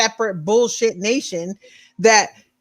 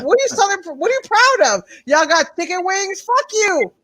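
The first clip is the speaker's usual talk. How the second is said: higher, scornful, dismissive jabs; mocking scorn; 7 semitones higher than usual. Real, not acted. Ranting at Southern pride, scornful and higher. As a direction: sneer at their pride.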